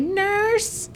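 put-on high, far-carrying old voice calling; high screechy old-lady cry; calling old patient. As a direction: call down the hall like a frail old patient. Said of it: "but then they're like".

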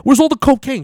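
high, loud, fast mock-tough ribbing; hammed-up accusing taunt; teasing buddy. Buddy teasing him on the phone, loud high mocking voice. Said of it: "He's like".

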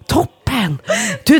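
ordinary talk, nothing marked

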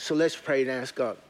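ordinary talk

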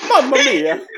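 high cartoon Italian exclamation; laughing Italian game-plumber catchphrase; Super Mario. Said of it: "sound like Super Mario".

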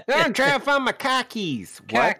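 high-pitched mock Boston Yankee; Yankee accent. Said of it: "you sound like a Yank".

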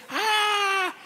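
high excited shriek; mock judges' scream. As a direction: squeal like thrilled judges. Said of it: "The, the judges were standing".

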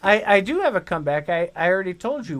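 rushed defensive reply; fast. Real, not acted. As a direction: hurry the words out. Quick defensive comeback.

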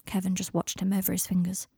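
ordinary delivery, nothing marked

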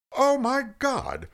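stunned as it dawns on him; stunned squeaky Moses. A narrator's reading. Moses realizes who's speaking, squeaky and stunned. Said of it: "And Moses said".

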